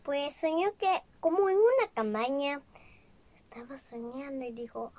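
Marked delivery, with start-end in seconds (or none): 3.3-4.9 whispered narration; hushed storyteller whisper. Real, not acted. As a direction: drop to a breathy whisper.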